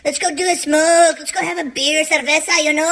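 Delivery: put-on high Mexican-accented cousin. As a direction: coax him in a Mexican accent. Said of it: "He's like".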